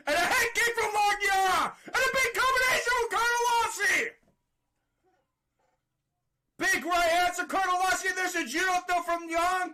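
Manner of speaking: high, loud, fast blow-by-blow; shouted call. Real, not acted. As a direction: hammer out each strike. Wild exchange called live.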